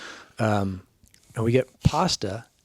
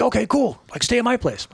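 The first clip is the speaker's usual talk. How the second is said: mock Kurt voice; 10 semitones higher than usual. Kurt voiced in a retold story.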